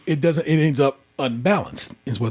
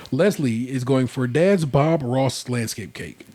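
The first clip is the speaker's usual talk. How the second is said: ordinary delivery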